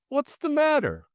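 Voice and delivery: high, bewildered question; dazed, thick-voiced. A narrator's reading. Jimmy coming to among the crowd, dazed. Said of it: "he said thickly".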